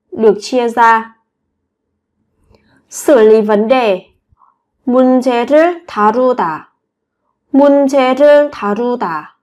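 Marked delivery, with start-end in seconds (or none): none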